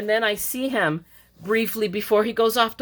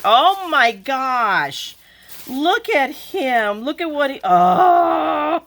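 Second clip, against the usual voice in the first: high, drawn-out gushing awe; overwhelmed, slow high cooing; touched delight; 6 semitones higher, 5 dB louder, much slower than usual. Real, not acted. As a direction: gush slowly, rising into a big loud "oh". Delighted by a friend's gift.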